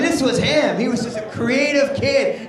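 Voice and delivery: usual speaking voice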